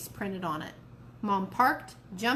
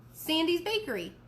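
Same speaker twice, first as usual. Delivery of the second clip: high stunned question on Bakery; disbelieving, voice jumps up; surprised; 8 semitones higher than usual. Acted. Mom stunned by the answer.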